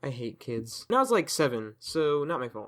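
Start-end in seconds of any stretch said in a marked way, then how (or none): 0.0-0.9 deadpan grumble. Acted. Grumbling after the kid squeal.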